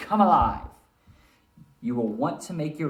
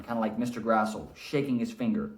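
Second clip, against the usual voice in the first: normal talking voice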